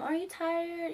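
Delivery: put-on high, cooing baby-talk question. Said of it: "like, in a baby way".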